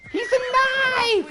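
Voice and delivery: very high, loud, slow cry of joy; jubilant cry. Real, not acted. Celebrating that his horse survived the jump. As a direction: crow it like a mad scientist.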